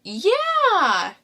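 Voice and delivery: high, loud drawn-out yeah; emphatic yeah. Real, not acted. Girlfriend agreeing he's a Joffrey, emphatic yeah. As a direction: agree wholeheartedly.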